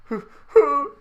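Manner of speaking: high, loud hammed-up sobs; mock sobbing. Sally over his dead body, hammed-up sobbing. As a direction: wail it in exaggerated little sobs. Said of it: "she sobs".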